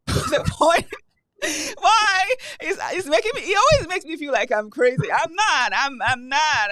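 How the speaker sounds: loud, high, mock-indignant denial; laughing protest. Real, not acted. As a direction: laugh while insisting you are not crazy.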